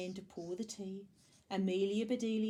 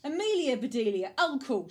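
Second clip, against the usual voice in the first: loud, raised call of the names; scolding shout; 5 semitones higher, 9 dB louder than usual. A narrator's reading. Missus Rogers rebukes the two with the hose, shouting. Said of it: "shouted Missus Rogers".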